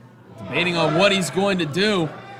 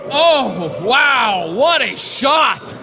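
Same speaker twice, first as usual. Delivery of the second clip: excited shout; 4 semitones higher, 5 dB louder, much slower than usual. Real, not acted. Big hit lands in match.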